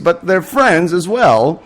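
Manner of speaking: ordinary talk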